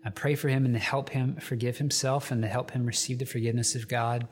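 usual speaking voice

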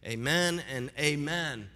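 drawn-out closing amen; slow amen. Acted. Pastor closing his prayer with a slow amen. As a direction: let the amen settle slowly.

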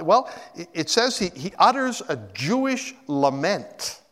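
ordinary talk

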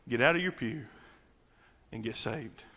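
normal talking voice

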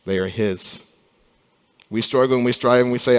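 normal talking voice

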